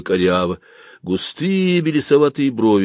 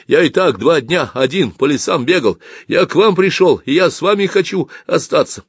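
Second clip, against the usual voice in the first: raised, loud, insistent plea; alarmed pleading; 9 semitones higher, 4 dB louder than usual. Acted. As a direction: plead not to be left alone. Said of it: "испугавшись возможности опять остаться одному". (испуганно, настойчиво)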